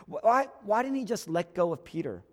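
ordinary talk, nothing marked

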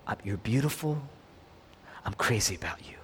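soft, low, near-whispered endearment; whispering. Acted. Someone whispers into our ears when we hate ourselves, hushed. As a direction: say it hushed, close to the ear. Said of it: "who can whisper into our ears".

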